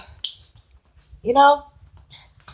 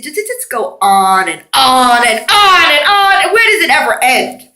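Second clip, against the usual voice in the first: exasperated loud question; 3 semitones higher, 6 dB louder than usual. Retold or imitated. Retelling questions put to the preachers, loud exasperation. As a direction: belt out each repeat, louder and higher.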